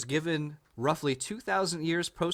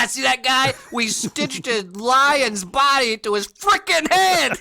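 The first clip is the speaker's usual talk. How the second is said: high, loud, laughing showman; a hammed-up ancient comic presenting his creature; mock Egyptian showman; 9 semitones higher, 8 dB louder than usual. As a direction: show off the stitched monster to the crowd. Mock ancient comedian showing creation.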